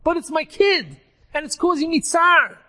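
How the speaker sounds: dead father urging help for his son; pleading father. Acted. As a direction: beg for the sake of his child.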